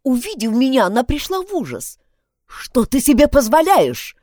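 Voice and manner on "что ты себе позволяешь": loud alarmed cry for the guards; nurse shouting. Acted. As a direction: yell for security, outraged. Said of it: "закричала она".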